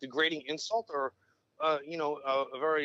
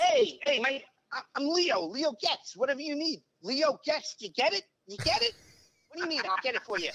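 high, fast-talking Leo Getz whine, much higher pitch; Joe Pesci as Leo Getz; 10 semitones higher, 3 dB louder than usual. Retold or imitated. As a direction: rattle it off like a pushy eager sidekick. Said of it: "do a Joe Pesci from Lethal Weapon".

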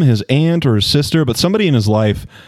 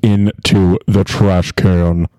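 pilot voice; much slower than usual. Pretend. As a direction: talk like an airline captain. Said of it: "It sounds like a, a pilot on an airplane".

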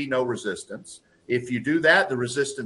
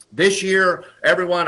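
higher, louder opening to a speech; CEO announcing; 5 semitones higher, 6 dB louder than usual. Retold or imitated. CEO opening the year's speech to staff, raised voice. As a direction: launch a company announcement. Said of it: "he said".

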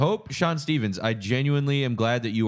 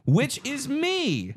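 laughing; 14 semitones higher, much slower than usual. Real, not acted. Laughing while reading own message.

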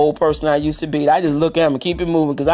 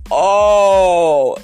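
"all" stretched very long and slow, slightly louder; drawn out long; 3 semitones higher, 5 dB louder than usual. Shown as a demonstration. Preacher stretching the word out for the flock, very slow and long. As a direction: draw out "all our sins" for the congregation. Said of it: "I'm gonna say it long".

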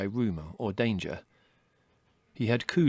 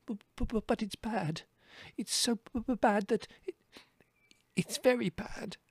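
high, soft, stuttering little rabbit; miserable stammer; 13 semitones higher, 4 dB quieter than usual. A narrator's reading. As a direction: stutter on the b's in a tiny high voice. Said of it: "he concluded miserably".

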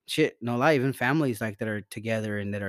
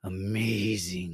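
slow, lower, stretched-out amazing; drawn-out amazing; 4 semitones lower, much slower than usual. Shown as a demonstration. Answering how he feels with a slow, swooning amazing. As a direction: linger on it like a gushing host. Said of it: "Ask, ask".

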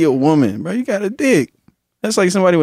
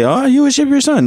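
mock accuser; 11 semitones higher than usual. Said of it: "y'all can't say".

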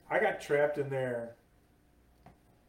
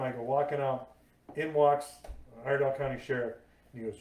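ordinary talk, nothing marked

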